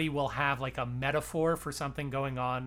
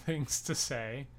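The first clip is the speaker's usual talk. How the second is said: normal talking voice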